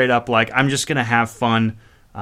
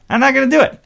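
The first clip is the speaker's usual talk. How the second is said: much higher, louder insistence; emphatic refusal; 12 semitones higher, 5 dB louder, faster than usual. Real, not acted. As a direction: pitch jumps up on DO, louder. Repeats himself, second time emphatic.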